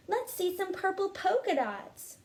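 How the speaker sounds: put-on kid yelling. Classmate teasing Camilla in class, high taunting yell. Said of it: "One yelled out".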